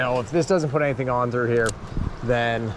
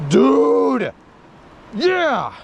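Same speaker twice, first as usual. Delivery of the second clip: high, louder triumphant whoop; real excitement; 9 semitones higher, 4 dB louder than usual. Real, not acted. Angler cheering his personal best flathead, loud high whoop.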